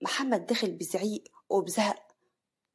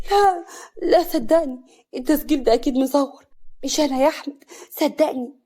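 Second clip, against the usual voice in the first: high, loud, tearful denial; frightened pleading; 6 semitones higher, 6 dB louder than usual. A narrator's reading. Wife denying betrayal to furious husband, frightened pleading. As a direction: plead desperately as you back away. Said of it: "كريمة بخوف وهي بترجع لورا".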